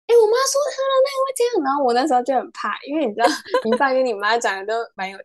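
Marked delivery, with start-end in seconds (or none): 0.1-1.5 high, fast mock-whiny childish voice; much higher, quick imitation of a tattletale; imitating friend. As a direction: squeak it like a tattling kid. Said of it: "然后你就说".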